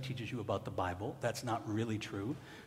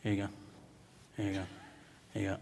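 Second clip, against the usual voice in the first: joyless mimic; 5 semitones lower, 7 dB quieter, much faster than usual. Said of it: "she's going like this".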